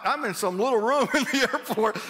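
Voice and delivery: laughs through the words; chuckling at his own predicament; amused, half-laughing aside. Real, not acted. Texting wife from locked room.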